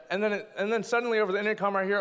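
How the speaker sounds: ordinary delivery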